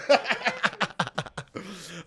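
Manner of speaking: rapid chuckling laugh; amused cackling chuckle. Real, not acted. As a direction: burst out chuckling at your own joke. Laughs at own mock demand.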